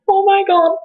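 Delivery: very high, mock-tearful gushing; emotional mom. Mom hearing the whole story, mock-tearful gushing. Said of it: "She started, like, literally crying".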